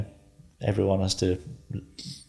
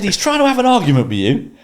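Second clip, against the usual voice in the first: much higher, louder exasperated wife voice; hammed-up impression of a fed-up wife; imitating wife; 6 dB louder than usual. Wife telling him he never takes the bait, high exasperated impression. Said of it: "She went".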